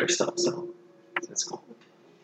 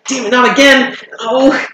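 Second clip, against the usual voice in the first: frustrated creature voice; 13 semitones higher, 3 dB louder than usual. Acted. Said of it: "It's like".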